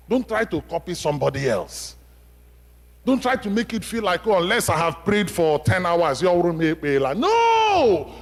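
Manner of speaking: rising to a loud, high cry of no; fiery preaching. Acted. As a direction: build up and burst out with the no. Preacher rebukes copying others' prayers.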